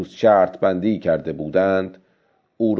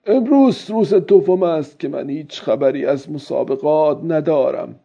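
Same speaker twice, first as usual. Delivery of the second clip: high character voice urging himself on; higher-voiced self-encouragement; old fisherman thinking to himself; 6 semitones higher than usual. A narrator's reading. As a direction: muse aloud as the old man, pitched up.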